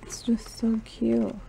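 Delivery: normal talking voice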